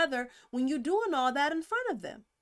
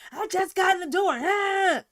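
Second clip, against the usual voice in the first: loud, higher-pitched mock outburst; imitated domestic yelling; 6 semitones higher, 7 dB louder than usual. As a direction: yell it like someone ambushed at the door. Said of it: "that's how they talk".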